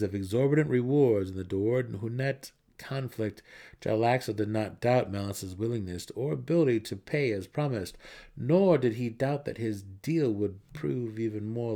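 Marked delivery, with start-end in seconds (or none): none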